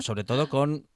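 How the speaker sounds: usual speaking voice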